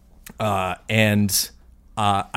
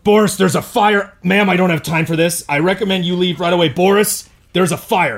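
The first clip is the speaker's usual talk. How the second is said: urgent calling out; 7 semitones higher than usual. Acted. Urgent fire warning to guard.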